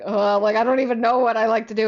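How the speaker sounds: loud, fast, imitated panic; flustered blurting. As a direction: mimic a friend put on the spot. Imitating friend put on the spot.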